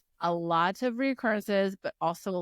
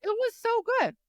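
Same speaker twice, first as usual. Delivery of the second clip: very high, gleeful squeal; squealing delight; faster than usual. Real, not acted. Cohost teasing friend about finishing the wine, squealing.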